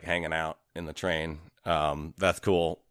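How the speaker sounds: ordinary talk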